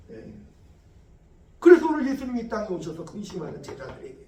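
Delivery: ordinary talk, nothing marked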